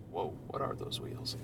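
hushed, surprised whisper. Real, not acted. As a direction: breathe it out in awe. Reacting to a video, hushed.

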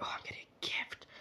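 hushed, breathy glee; excited whisper. Retold or imitated. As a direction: whisper it like a thrilled child. Excited child retold.

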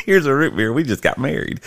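high, laughing mock toast; mock the romance through laughter. Real, not acted. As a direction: son teasing his dad about root beer, laughing high. Son mocking dad's romance.

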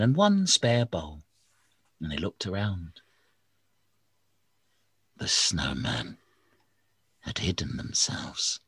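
usual speaking voice

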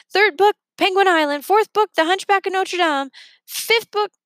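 high, chirpy reciting voice; Blop's voice. Acted. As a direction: rattle off the list like a proud little creature. Blop recites book list.

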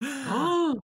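high, loud gasped "oh"; shocked gasp. Real, not acted. Player on hearing of cultists and a little boy, shocked gasp. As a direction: gasp sharply in alarm.

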